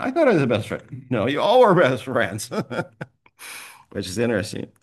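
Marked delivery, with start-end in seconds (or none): 0.0-1.1 higher, louder mock protest from a funeral guest; hammed-up offended mourner insisting; mock indignant mourner. Funeral guest claiming friendship, mock indignant and higher. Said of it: "People come".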